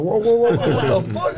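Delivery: high, loud startled protest; mock alarmed whoa. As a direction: back off, shocked and loud. His startled reaction retold.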